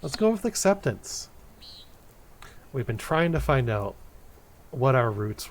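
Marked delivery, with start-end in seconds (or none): 0.0-1.3 high, eager choice; excited. Real, not acted. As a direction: pick it with a jump of glee. Choosing a game option excitedly.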